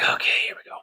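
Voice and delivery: soft whisper; whispered under the breath before sitting down; whispered to self. Retold or imitated. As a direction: breathe it to yourself, bracing. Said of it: "So I'm like".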